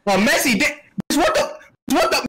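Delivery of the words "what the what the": ordinary talk, nothing marked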